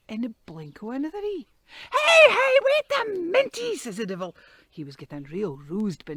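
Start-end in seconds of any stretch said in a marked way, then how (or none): 1.9-3.8 high, loud panicky protest; alarmed shout. Acted. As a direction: cry out to make him stop. Devil dodging boulders.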